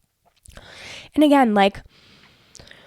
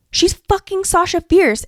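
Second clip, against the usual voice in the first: conviction; 8 semitones higher, slower than usual. Shown as a demonstration. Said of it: "say it with conviction".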